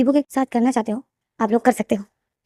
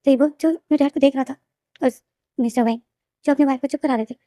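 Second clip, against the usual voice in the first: ordinary delivery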